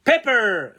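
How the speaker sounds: paper with loud breathy P at the start; paper, pop both P's with air; aspirated P. Shown as a demonstration. Teacher giving paper as a P example. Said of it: "وزي كمان".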